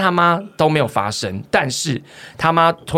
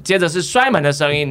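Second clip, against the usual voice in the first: ordinary delivery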